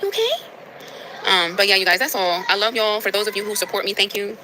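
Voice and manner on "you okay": high soft coo. Real, not acted. Woman checking on her cat in baby talk. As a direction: softly fuss over the cat.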